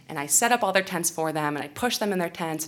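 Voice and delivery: usual speaking voice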